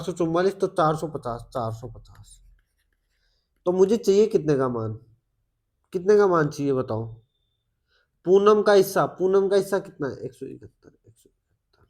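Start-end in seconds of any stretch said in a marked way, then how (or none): 1.4-2.4 slow, soft whisper to himself; whispered repeat. Real, not acted. Teacher repeats total to himself. 10.2-11.0 much softer, muttered answer. Real, not acted. Teacher states the answer. 11.1-11.9 fading, breathless whisper; whispered echo. Real, not acted. Teacher repeats answer to himself.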